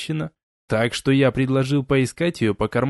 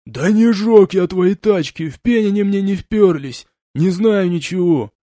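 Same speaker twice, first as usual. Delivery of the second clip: russian street thug; 5 semitones higher, 4 dB louder than usual. A narrator's reading. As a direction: deny it like a scared punk. Gopnik denying. (гопник, отпираясь)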